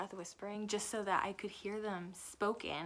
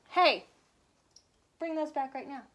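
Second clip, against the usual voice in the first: loud, higher scolding call; real scolding; 6 semitones higher, 9 dB louder than usual. Real, not acted. Mom calling out loud and high to the child who stole her glasses.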